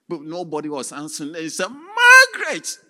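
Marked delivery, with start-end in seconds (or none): 1.7-2.8 very high, loud, slow crying call; desperate shout. Acted. Husband banging on his own door, crying shout. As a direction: call her through the door, pleading.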